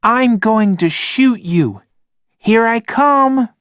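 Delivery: put-on high, loud kid voice; playful child's shout. A narrator's reading. Logan chasing his friend Rob, high excited kid shout. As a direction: call out like a boy in the playground. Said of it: "shouted Logan".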